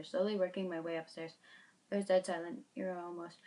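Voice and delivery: plain reading voice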